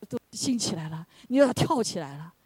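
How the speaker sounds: ordinary talk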